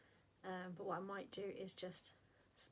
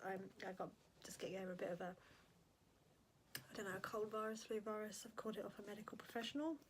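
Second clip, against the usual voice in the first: ordinary delivery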